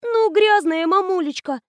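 the daughter answers her mother, baffled; bewildered answer. A narrator's reading. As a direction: play confused innocence. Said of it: "растерянно ответила Аня".

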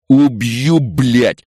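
loud, high angry yell; enraged shout; furious threat. A narrator's reading. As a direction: roar it as a threat. Enraged attacker threatens to kill.

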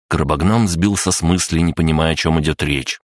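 plain reading voice

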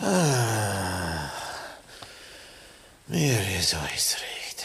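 put-on faint, breathy old man's murmur; barely voiced, too weak to speak up. Sick old man answers weakly. (schwacher, gehauchter Alter)

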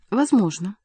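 ordinary delivery, nothing marked

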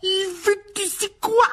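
very high, slow frightened whine; shrill scared child; panicked tattling. A narrator's reading. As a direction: blurt it out in terror. Dudley fleeing in panic.

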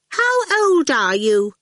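put-on high, loud child's demand; sudden demand. A narrator's reading. Awful, staring at Dillion, suddenly demanding. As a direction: blurt it out high and insistent. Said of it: "Awful suddenly demanded".

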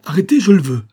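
high, loud order to stop; commanding cry. A narrator's reading. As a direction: cry out to halt them. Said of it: "s'écria Olivia". (cri impérieux)

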